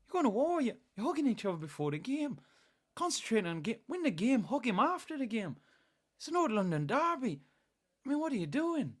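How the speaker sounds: high-pitched mock Irish accent; incredulous Irish pundit telling players off; Roy Keane. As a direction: put on an exasperated Dublin-ish accent, scolding in disbelief. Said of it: "Imagine what Roy Keane would say".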